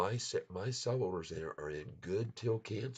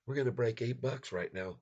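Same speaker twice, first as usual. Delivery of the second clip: excited; 6 semitones higher, 5 dB louder than usual. Real, not acted. Price hits eight.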